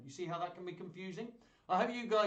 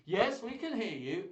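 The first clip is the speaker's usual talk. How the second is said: slow, loud, higher studio reply; hammed-up news anchor answering late; delayed studio anchor; 6 semitones higher, 6 dB louder, slower than usual. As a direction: answer as if the words arrived a minute late. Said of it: "and they'd go".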